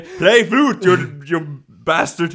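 loud, barked mock order; loud pretend scolding; mock strict teacher. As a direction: order the pupil around, hammed up. Acts strict music teacher ordering pupil.

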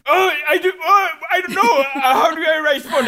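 a flustered tourist, badly faked fear; panicked confusion. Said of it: "and you're like".

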